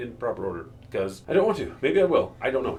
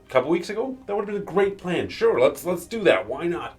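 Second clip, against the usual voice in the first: sarcastic; 8 semitones higher, 4 dB louder than usual. Real, not acted. Mocking the villains' plan.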